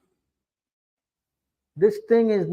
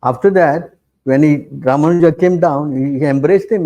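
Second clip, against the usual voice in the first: 1 raised voice; 8 dB louder than usual. Real, not acted. Emphatic retelling of Ramanuja story.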